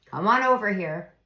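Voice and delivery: hup, hold on, come here; calling the kids over. Shown as a demonstration. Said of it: "You say".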